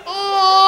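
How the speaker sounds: put-on gasp, then bawl like a baby; imitated baby cry. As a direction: gasp into a loud baby wail. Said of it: "I can cry like a baby".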